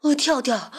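loud, out-of-breath character voice; panting. A narrator's reading. Exhausted rabbit reaching his friend, panting. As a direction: puff heavily round the words. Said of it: "蹦蹦喘着粗气".

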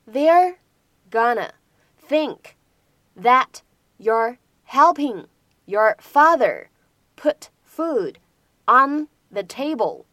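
They're, gonna, think said apart, then slow "helping your father"; slow word by word. Shown as a demonstration. Teacher slowing the line down for students, slow and chunked. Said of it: "有这样的几处发音技巧".

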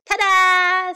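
high, loud, drawn-out fanfare; big acted reveal; triumphant. A narrator's reading. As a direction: present yourself with a flourish. Sam presents himself, dressed.

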